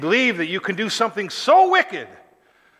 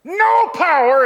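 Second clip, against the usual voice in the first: NO POWER high, loud and stretched; emphatic; 9 semitones higher, 6 dB louder, slower than usual. Acted. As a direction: hit "No power" hard, both words slow and raised. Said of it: "he says it like that".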